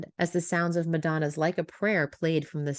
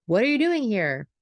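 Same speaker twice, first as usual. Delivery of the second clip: louder, higher irritated question; annoyed; 5 semitones higher, 5 dB louder than usual. A narrator's reading. Emma confronting Hank in the doorway, annoyed. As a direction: demand it with annoyance. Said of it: "Emma asked, annoyed".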